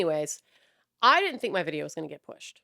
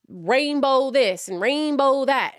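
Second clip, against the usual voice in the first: slow, sneering mockery of rainbow merch; mocking; 5 semitones higher, 3 dB louder, slower than usual. Real, not acted. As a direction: drag it out with contempt. Mocking corporate rainbow push.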